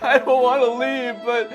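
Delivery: hammed-up weepy kid; mock sobbing third grader. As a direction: play a crying little boy being exiled. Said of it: "a third grader packing up his bag sobbing".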